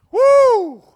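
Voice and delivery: high, loud preacher's whoop; excited whoop. Real, not acted. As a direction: let out a big woo.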